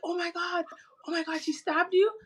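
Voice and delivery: high-pitched retold disbelief; shocked. Friends learn she was stabbed, mock shock. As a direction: imitate aghast friends, rising at the end.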